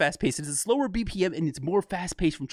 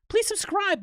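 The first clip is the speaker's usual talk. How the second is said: excited plea. Real, not acted. Asking viewers to subscribe.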